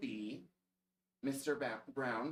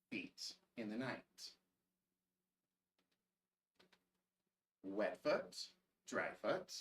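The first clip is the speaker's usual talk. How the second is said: put-on reading to children; 3 semitones lower than usual. A narrator's reading. Reads a picture book aloud.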